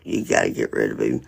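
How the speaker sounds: deadpan order from a city official, rushed; mock city lady.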